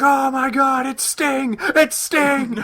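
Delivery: high, mock excited fan yell. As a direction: squeal like fans spotting a legend. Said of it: "everyone's like".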